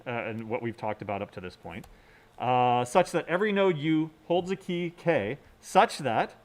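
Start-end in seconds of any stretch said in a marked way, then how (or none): none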